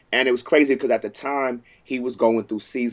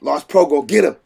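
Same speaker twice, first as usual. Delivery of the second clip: higher, louder and fast, in a laughing panic; mock panic; 6 semitones higher, 7 dB louder, faster than usual. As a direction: blurt it out like you're about to lose him. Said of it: "And I was like".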